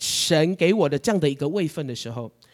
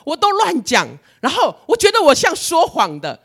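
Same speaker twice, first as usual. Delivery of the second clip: high, loud aggrieved whine; upset complaint; 10 semitones higher, 6 dB louder than usual. Retold or imitated. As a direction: pour out the hurt, pitched up. Retells complaint to God. (对神委屈地高声抱怨)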